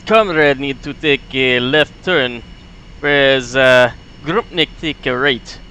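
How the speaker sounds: put-on light Russian accent with broken grammar; Soviet accent. Grumpnik reading the chart for comrade, light Russian accent. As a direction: stay in the comrade role. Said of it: "Soviet sound voice".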